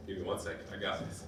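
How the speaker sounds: choked, barely voiced, almost a whisper; holding back tears. Real, not acted. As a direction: fight back the tears to get the words out. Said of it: "it's gonna be a little emotional".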